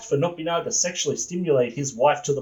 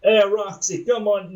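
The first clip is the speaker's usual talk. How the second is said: loud, higher attempt at a southern accent; 6 semitones higher, 4 dB louder than usual. Boss greets employee in an attempted southern accent. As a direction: have a go at a wobbly southern accent. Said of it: "try to put on a southern accent".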